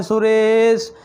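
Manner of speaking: सुरेश with रे stretched very long; three-beat calling vowel. Shown as a demonstration. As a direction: demonstrate a drawn-out call of सुरेश. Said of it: "तीन बार का उच्चारण वहाँ पर करते".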